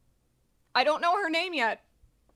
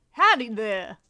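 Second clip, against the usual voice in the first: louder Southern howdy; Southern accent; 3 semitones lower, 6 dB louder than usual. Shown as a demonstration. Streamer rehearsing the chosen character voice, Southern accent. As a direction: punch the greeting in a Southern accent. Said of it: "Yeah, well, she'll be Southern".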